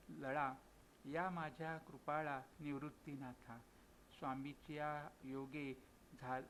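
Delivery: slow, emphatic recitation of a devotional verse. A narrator's reading. Tears speaking to Nivruttinath, slow emphatic verse recitation.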